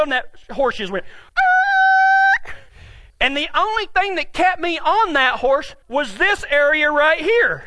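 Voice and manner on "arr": very high, sustained squeal; screeching halt noise. Retold or imitated. Storyteller showing the horse stopping dead, screeching halt noise. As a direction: imitate a horse screeching to a stop.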